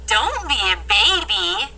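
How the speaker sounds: ordinary delivery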